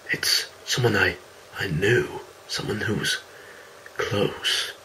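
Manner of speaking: hushed. Acted. Recalling someone close.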